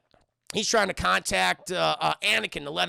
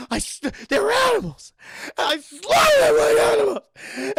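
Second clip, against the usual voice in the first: starts tense, builds into a much higher, louder anguished yell; building shout; 9 semitones higher, 7 dB louder than usual. Retold or imitated. Anakin confessing the massacre to Padme, building from tense to screaming. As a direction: let the confession swell into a full roar.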